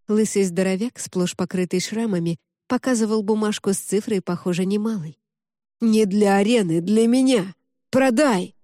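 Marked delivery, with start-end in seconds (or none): 5.8-8.5 louder and rising, pushing to buy; demanding shout. A narrator's reading. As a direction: shout the bid over the crowd. Bald brute bids for her.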